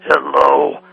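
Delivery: very slow, drawn-out giant; deep giant voice. Acted. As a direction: play a big lumbering giant, as deep as you can go. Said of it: "I can go pretty deep".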